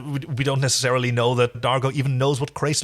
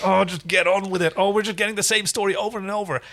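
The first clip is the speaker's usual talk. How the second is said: mock exasperation; 8 semitones higher than usual. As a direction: whine at the show to hurry up. Imitating her old impatient self.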